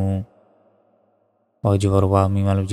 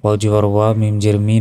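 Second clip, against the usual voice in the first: usual speaking voice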